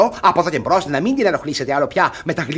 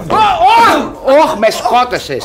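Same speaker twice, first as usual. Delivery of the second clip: high, loud yelp then a pained groan; cry of pain; 9 semitones higher, 6 dB louder, much slower than usual. Acted. Old guardian knocked down in the street, yelping in pain. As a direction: howl as if someone just slammed into you.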